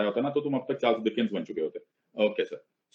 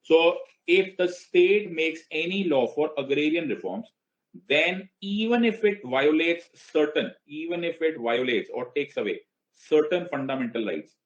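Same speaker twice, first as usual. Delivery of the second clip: slow, stretched-out, emphatic recitation; declaimed reading; 5 semitones higher, much slower than usual. Acted. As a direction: spell out the law phrase by phrase. Declaims the article line by line. (धीमे, खींचकर, ज़ोर देते हुए पाठ)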